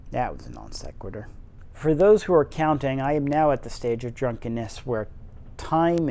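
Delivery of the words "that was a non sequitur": muttered aside. Real, not acted. Low drunk aside to listeners.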